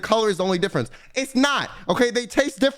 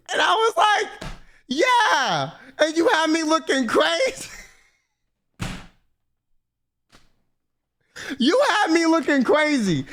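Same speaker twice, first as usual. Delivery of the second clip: laughing vindication; 7 semitones higher, slower than usual. Real, not acted. Telling Daph she was wrong, laughing triumphantly. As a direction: crow it through laughter.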